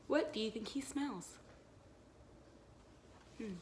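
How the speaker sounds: normal talking voice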